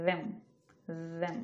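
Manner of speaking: "them" with a buzzing th off the tongue tip; careful isolated "them"; voiced th. Shown as a demonstration. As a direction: model "them" short and separate. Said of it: "এই ডগায় উপরে পাটি দাঁত রেখে".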